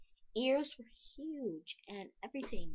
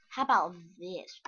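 ordinary talk

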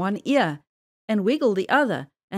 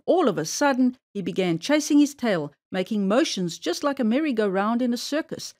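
ordinary delivery, nothing marked

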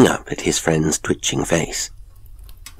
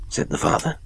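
ordinary delivery, nothing marked

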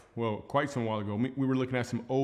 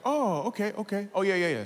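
mock eager agreement; 8 semitones higher than usual.

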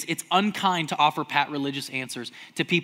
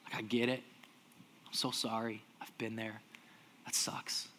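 gentle comfort; 6 semitones lower, 11 dB quieter than usual. Retold or imitated. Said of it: "most healing words that can ever be spoken".

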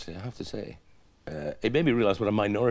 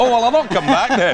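loud, high-pitched mock offence; mock huff; 9 semitones higher, 10 dB louder than usual. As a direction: act put out and threaten to walk off. Mock quarrel with the host.

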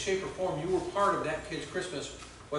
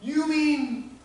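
put-on much higher, slow amazed child voice; awed little kid; 10 semitones higher, 5 dB louder, much slower than usual. Pastor voicing the boy learning of Jesus, high and awed. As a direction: breathe it out in wonder like a small boy. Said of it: "he goes".

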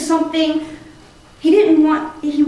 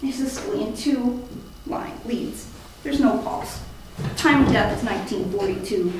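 hushed, defeated; 6 semitones lower, 9 dB quieter than usual. Acted. Declaring time of death.

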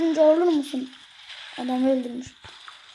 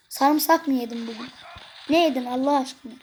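ordinary talk

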